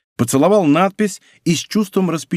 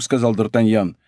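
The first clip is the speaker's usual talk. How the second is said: ordinary delivery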